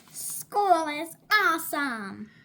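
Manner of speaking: loud, slow, shouted line; shouting with joy at school; child's shout. Acted. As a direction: belt it out like an excited shark. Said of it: "shouted Clark the shark".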